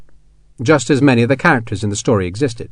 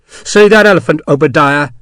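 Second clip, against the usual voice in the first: higher, louder taunting question; menacing taunt; 4 semitones higher, 6 dB louder than usual. Acted. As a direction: taunt him at the window. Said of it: "he asked".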